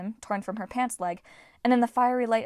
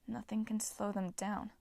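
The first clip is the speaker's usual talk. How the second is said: softer, slow, thoughtful repeat; musing; 10 dB quieter than usual. A narrator's reading. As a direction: turn the words over quietly. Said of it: "Leo mused".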